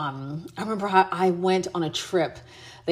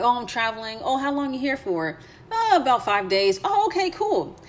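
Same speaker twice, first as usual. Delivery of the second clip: high-pitched, breezy imitated exchange; acted small talk; 6 semitones higher than usual. Traveler fobbing off a nosy stranger, mock casual. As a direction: bounce between both sides, pitched up.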